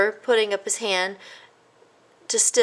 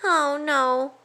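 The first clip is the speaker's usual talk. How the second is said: mumbled; 8 semitones higher than usual. A narrator's reading. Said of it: "mumbled Christopher".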